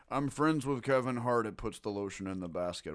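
low, creepy villain voice; Ted Levine impression. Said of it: "a pretty fucking good Ted Levine".

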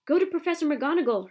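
urgent pleading to a frightened friend; urging. A narrator's reading. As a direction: press him to go at once. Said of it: "Hermione urged Neville".

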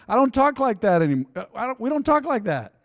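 high, emphatic objection; mock protest. Voicing people baffled by old English.

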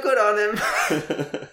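slow, high sarcastic praise. Real, not acted. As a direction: drag it out sarcastically.

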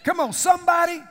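loud, high preacher's call to the crowd; preaching at full force. Acted. Preacher calling out to his congregation, loud and high. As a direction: rouse the congregation.

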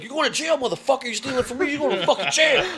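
high furious yell; screaming in his face. Retold or imitated. Taylor screaming at the caught thief, furious high yell. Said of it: "just screaming at him".

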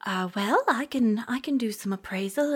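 ordinary talk, nothing marked

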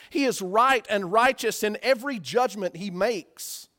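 ordinary talk

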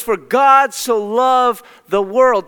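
slow, loud verse with God punched; stress on God. Shown as a demonstration. Preacher correcting the twisted verse, slow and loud. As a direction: lean hard on "God" in "For God so loved the world". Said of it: "Don't get it twisted".

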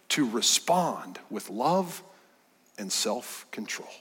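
slow, trailing into a whisper; hushed whisper. Real, not acted. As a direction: let it fade into a whisper. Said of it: "sermon emphasis".

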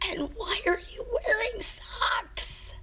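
very high, slow whispered cry; a silent scream of disbelief; mental shout. Acted. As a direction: shriek it under your breath. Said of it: "He simply shouted in his head".

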